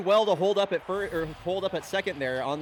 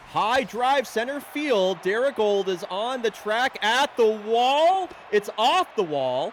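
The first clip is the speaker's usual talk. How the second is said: loud, high, stretched-out call; excited play-by-play; 6 semitones higher, 6 dB louder, slower than usual. Real, not acted. Announcer calling a drive off the wall, excited. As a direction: build suspense as the ball carries, loud and drawn out. Live call of a big hit.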